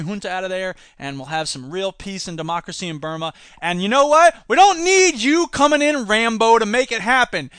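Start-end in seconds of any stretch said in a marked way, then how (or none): 3.6-7.5 loud, high telling-off; loud scolding. Real, not acted. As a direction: raise your voice to scold. Telling off Rambo.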